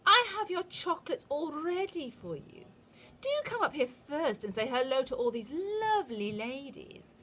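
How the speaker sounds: drip syrup on every word; syrupy witch. A narrator's reading. Witch coaxing Bruno onto the platform, high syrupy coo. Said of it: "absolutely dripping with syrup".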